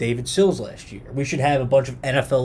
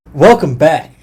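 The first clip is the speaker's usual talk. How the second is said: TV host welcome; 2 semitones higher, 12 dB louder, slower than usual. Pretend. Mock show host intro.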